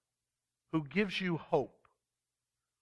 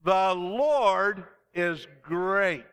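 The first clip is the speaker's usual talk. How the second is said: slow, loud, higher proclamation; call and response; 3 semitones higher, 6 dB louder, much slower than usual. Shown as a demonstration. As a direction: lead the congregation in a shout. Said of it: "you need to say it with me".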